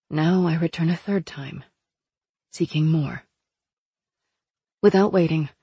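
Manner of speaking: usual speaking voice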